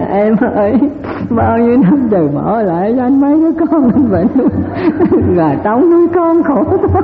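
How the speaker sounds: hammed-up sobbing through giggles; mock widower's lament. Widower mock-mourning his wife, left alone with the children, laughing lament. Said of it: "Vợ chết thì chồng cũng khóc".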